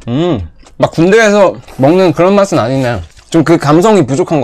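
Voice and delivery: eating and speaking between bites; talking while chewing. Real, not acted. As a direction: mouth partly full of food. Seen 0: Cheeks full and chewing after bite through about 4.1s; later clear.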